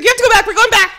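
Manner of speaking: high, loud, urgent push; excited insistence. Retold or imitated. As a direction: mimic the guard's eager urging. Excited guard insisting, retold.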